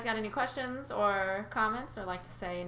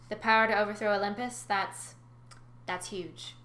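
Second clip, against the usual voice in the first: usual speaking voice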